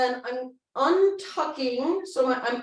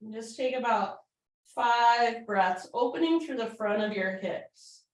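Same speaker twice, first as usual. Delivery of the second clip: soothing yoga cue; 3 semitones lower, 4 dB quieter than usual. Acted. Calm cool-down cueing.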